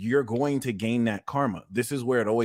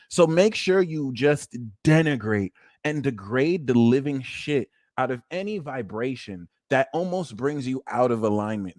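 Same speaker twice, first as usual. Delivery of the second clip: usual speaking voice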